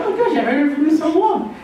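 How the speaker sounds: high, gushing phone hello; lightly hammed-up delight; mock delighted greeting. Acted greeting to forgotten caller.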